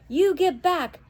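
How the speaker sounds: put-on high, loud boyish shout; a boy shouting to distract the grown man. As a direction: yell to draw them away. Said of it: "shouted Jack".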